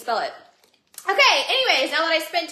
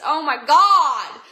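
loud, drawn-out exclamation; exasperated outcry; 8 dB louder, much slower than usual. Real, not acted. Streamer spilled ketones all over the place, exasperated outcry.

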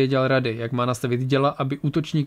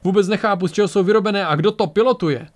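higher, exasperated grumble; complaining; 6 semitones higher, 4 dB louder than usual. A narrator's reading. Defender baffled by who pilots the attackers, complaining. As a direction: complain in raised, baffled frustration. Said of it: "postěžoval si".

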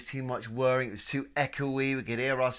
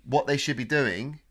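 normal talking voice

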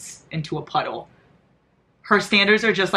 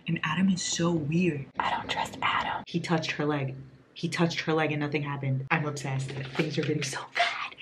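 soft, low near-whisper; hushed whisper; 3 semitones lower, 7 dB quieter than usual. Real, not acted. Late-night reader confiding doubts about Adam, hushed whisper.

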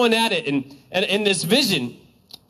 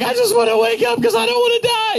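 panicked cry; 14 semitones higher, faster than usual. Retold or imitated. Preacher retelling his dream, crying out in panic. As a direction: cry out as if about to die in a dream.

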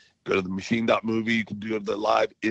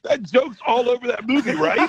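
high, laughing words; laughing while speaking; 11 semitones higher than usual. Real, not acted. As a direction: talk through a real laugh. Laughing at friend's joke.

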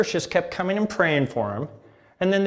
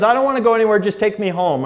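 higher, louder, brushing dad off; irritated son; 5 semitones higher, 5 dB louder, faster than usual. Retold or imitated. Son to family in car, higher louder and impatient. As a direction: push the family away, fed up. Son refusing lunch, wants home.

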